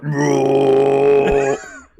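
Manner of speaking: long loud drawn-out mock roar; slow-motion scream. Acting out a slow-motion trailer shot, mock scream. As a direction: stretch out a slow-motion bellow. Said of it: "He's, like, screaming on the ball".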